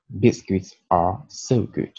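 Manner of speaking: "Biscuits are so good" read slowly, stress on "so"; slow example sentence. Shown as a demonstration. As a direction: read it slowly for learners to repeat. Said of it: "zero article". (phrase d'exemple lue lentement)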